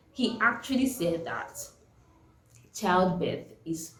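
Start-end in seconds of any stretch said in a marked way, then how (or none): none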